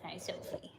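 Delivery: soft whispered greeting, almost no voice; whispered hello. Real, not acted. Greeting the hen Sophie up close, whispering. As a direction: breathe the name gently to the hen.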